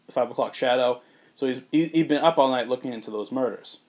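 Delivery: ordinary talk, nothing marked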